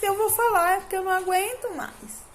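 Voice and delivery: high-pitched reenacted pleading; desperate plea. Mother asking God's forgiveness before speaking out, desperate plea. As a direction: raise the pitch, pleading without shouting. Acted desperate prayer to God.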